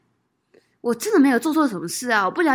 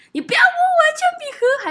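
高声尖喊的模仿怒吼; 学当时在中庭大喊的样子; retold yell; 8 dB louder than usual. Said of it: "我就说". (模仿当年在学校中庭大吼)

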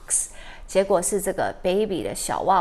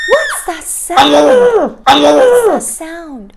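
high, loud, slow panicked shout; terrified cry; 6 semitones higher, 14 dB louder, much slower than usual. Acted. Biscuit hearing the baby cry for the first time, terrified shout. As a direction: cry out like a scared puppy. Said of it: "Biscuit is terrified".

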